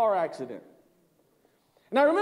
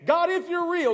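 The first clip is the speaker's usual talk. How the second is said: desperate plea; 8 semitones higher, 3 dB louder than usual. Retold or imitated. Retold desperate jail prayer.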